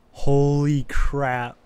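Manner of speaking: loud, slow stunned exclamation. Real, not acted. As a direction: drag it out in disbelief. Stunned by the blast.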